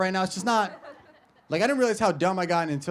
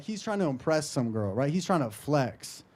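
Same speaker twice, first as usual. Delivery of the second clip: ordinary talk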